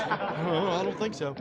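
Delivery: slow, laughing denial. Real, not acted. Answering the board's teasing, laughing. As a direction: chuckle out a slow no. Laughs denying he's crazy.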